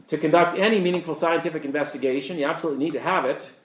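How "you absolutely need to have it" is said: ordinary talk, nothing marked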